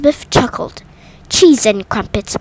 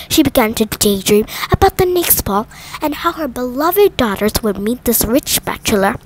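usual speaking voice

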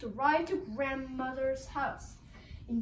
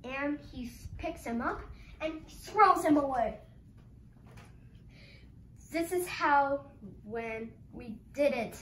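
ordinary delivery

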